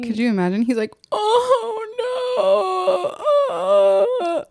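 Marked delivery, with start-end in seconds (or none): 1.1-4.4 high drawn-out fake crying wail; mock wailing. Imitating the crying man, hammed-up mock wail. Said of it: "crying man".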